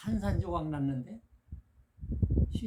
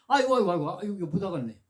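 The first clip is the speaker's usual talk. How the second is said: loud higher wails of pain, then giving up; acted groaning; 3 semitones higher, 6 dB louder, faster than usual. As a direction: moan aigo as if it really hurts. Customer groans under needle.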